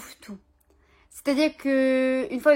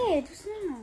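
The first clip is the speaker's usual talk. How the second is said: soft, high-pitched call to calm down; settling the dog; 6 semitones higher, 7 dB quieter than usual. Real, not acted. Owner breaks off filming to calm the dog, soft and high. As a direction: gently rein in an excited dog.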